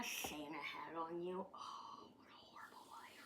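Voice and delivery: mock-pity whisper to the pet; teasing whisper. Real, not acted. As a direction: whisper a fake sympathy to a grumpy pet.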